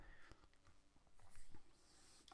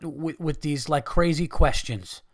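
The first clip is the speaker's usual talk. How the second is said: normal talking voice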